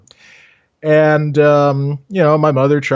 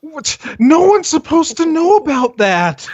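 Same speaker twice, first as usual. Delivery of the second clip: mock shock; 14 semitones higher than usual. Mock outrage at bucket joke.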